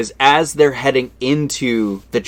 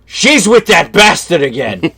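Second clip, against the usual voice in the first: louder, higher bitter growl of a jealous husband; 6 semitones higher, 6 dB louder than usual. Retold or imitated. J P hears his wife is with another man, bitter. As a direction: spit it out like the cheated husband. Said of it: "And then J P goes".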